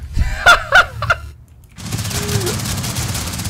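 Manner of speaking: high shrieking laugh; loud laughing shout. Real, not acted. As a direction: burst out laughing loudly. After dying to own grenade.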